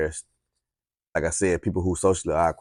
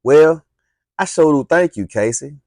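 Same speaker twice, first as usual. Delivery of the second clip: high, bright and loud thank-you; sweet high thanks, no sass; without attitude; 8 semitones higher, 8 dB louder than usual. Shown as a demonstration. Girl thanks Casey, sweetly and high. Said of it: "no, no, it wasn't with attitude".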